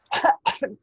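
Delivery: loud throaty cough. Acted. Lorax choking on smog, loud cough. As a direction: cough it out hard. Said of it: "He coughed and he whiffed".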